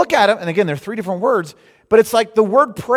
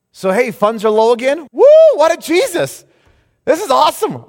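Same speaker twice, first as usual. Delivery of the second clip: high, loud whoop of joy; joyful, high, loud praise; mock elation; 7 semitones higher, 7 dB louder than usual. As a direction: celebrate the empty bank account. Rejoicing while funds are low.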